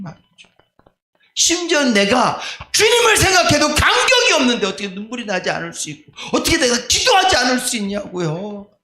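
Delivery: rising, pleading preacher's cry; preaching, voice climbing with feeling; impassioned preaching. Acted. Emotional plea to congregation.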